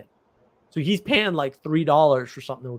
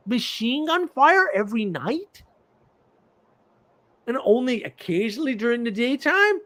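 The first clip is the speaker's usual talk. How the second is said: higher, slowed, mocking unimpressed tone; sarcastic incredulity; 8 semitones higher than usual. Mocking friend's blasé brag.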